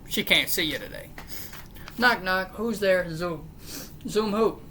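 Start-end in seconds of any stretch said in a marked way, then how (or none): none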